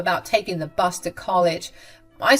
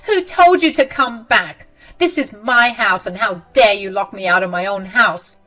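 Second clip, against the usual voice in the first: loud, raised indignant demand; angry; 3 semitones higher, 8 dB louder than usual. Acted. Mother-in-law furiously scolding Macy at the door. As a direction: confront her for the lockout.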